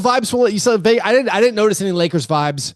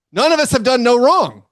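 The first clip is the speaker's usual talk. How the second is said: normal talking voice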